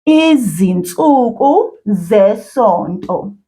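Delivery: "Izinsuku zesonto" drawn out slowly, word by word; whole phrase, slowly. Shown as a demonstration. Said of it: "let's say the whole thing at once".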